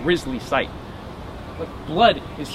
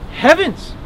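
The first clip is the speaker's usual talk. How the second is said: high, ladylike exclamation; startled gasped word; 11 semitones higher than usual. Acted. Woman hears of fugitive on the grounds, startled. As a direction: exclaim in genteel shock.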